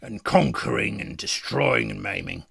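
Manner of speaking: lightly voiced battle-worn warrior musing on a career; deadpan in-character monologue; world-weary fighter. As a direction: reflect like a seasoned fighter after battle.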